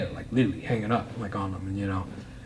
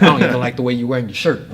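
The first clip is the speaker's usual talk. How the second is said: blunt jab; 2 semitones higher, 8 dB louder, faster than usual. Retold or imitated. Blunt remark when uncomfortable.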